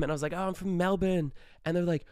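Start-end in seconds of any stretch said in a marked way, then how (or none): 0.3-1.3 higher-pitched put-on Australian; Australian accent. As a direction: pass yourself off as Australian. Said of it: "committed to the Australian accent".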